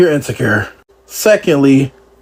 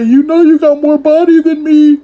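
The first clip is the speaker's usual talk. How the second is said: high mock whine of the birthday girl; lightly voiced jealous friend, higher; 10 semitones higher, 3 dB louder than usual. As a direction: imitate her jealous whine, pitched up. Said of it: "had the nerve to say".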